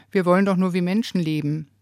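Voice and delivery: ordinary talk, nothing marked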